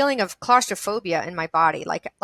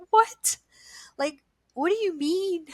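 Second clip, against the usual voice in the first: high-pitched, baffled protest; incredulous; 9 semitones higher than usual. Retold or imitated. Hearing she must fight evil, incredulous. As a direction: re-enact being taken aback. Retold disbelief at the mission given.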